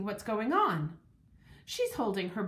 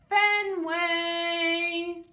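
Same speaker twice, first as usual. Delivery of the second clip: high, loud sing-song call of the dog's name; hopeful call; 6 semitones higher, 4 dB louder than usual. A narrator's reading. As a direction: call the dog sweetly, full of hope. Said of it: "she calls in a hopeful voice".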